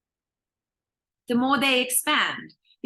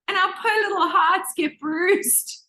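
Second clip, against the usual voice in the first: normal talking voice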